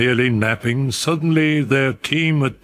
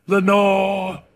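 echoed murmur; 10 semitones higher than usual. A narrator's reading. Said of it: "an echo murmured back".

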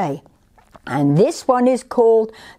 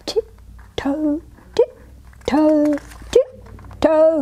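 high, light creeping chant; stealthy tiptoeing; 8 semitones higher, much slower than usual. A narrator's reading. Little tiger sneaking up to scare, stealthy. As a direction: place each word daintily, as if sneaking. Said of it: "as silently as he could".

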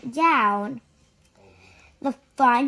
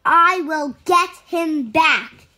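louder, firm promise; determined; 4 semitones higher, 7 dB louder than usual. A narrator's reading. Man promises to rescue George, firm and loud. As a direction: say it with resolve. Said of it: "he said".

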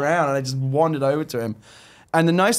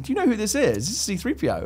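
excited intro; 9 semitones higher than usual. Retold or imitated. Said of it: "I said".

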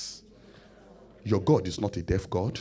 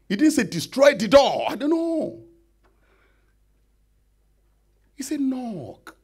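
emphatic exclamation; 13 semitones higher, 7 dB louder than usual. Acted. Preacher exclaims.

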